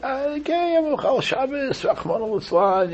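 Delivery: high apologetic imitation of a sinner. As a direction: sigh it out as a regretful admission.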